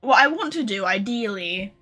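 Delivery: ordinary delivery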